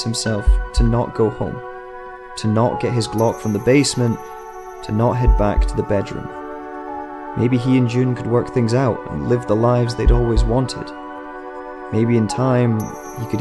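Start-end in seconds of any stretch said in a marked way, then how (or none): none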